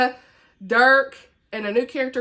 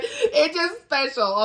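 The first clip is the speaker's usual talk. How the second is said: drawn out slowly, each word leaned on; slow, playful insistence on a beloved book; 4 semitones higher, much slower than usual. Real, not acted. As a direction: insist on it slowly, half-laughing. Defending a favourite book.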